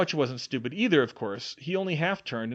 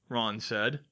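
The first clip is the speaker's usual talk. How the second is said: ordinary delivery, nothing marked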